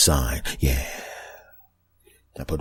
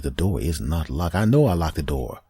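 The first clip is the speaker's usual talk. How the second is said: normal talking voice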